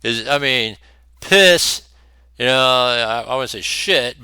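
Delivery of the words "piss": piss punched out high and loud; stressed word. Real, not acted. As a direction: spit out the word piss with disgust. Blunt emphatic word in rant.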